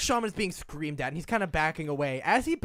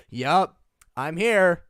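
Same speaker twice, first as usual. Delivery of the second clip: defeated; 9 dB louder, much slower than usual. Retold or imitated. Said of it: "such a defeated".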